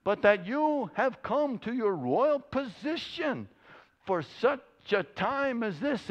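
high, slow, put-on old rabbi voice; a preacher's hammed-up rabbi, drawn out and high. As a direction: intone it slowly like a solemn old rabbi.

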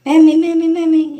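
put-on high, loud child's wail of Mammy; a child screaming for his mammy on the bus. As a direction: wail like a frightened little kid calling for mum. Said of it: "these two children in front of me start screaming".

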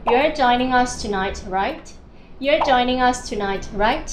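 slow, rising on "right"; slow model sentence. Shown as a demonstration. Teacher models the tag question slowly. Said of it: "ยกตัวอย่างเช่น".

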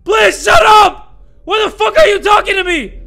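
very loud, high screaming; panicked angry yell. Real, not acted. Player yelling at the caller as time runs out, frantic. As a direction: yell desperately to silence them.

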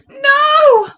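high, loud cry of no; frightened witch, acted big; witch's cry. A narrator's reading. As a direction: cry out in storybook alarm. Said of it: "cried the witch".

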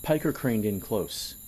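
usual speaking voice

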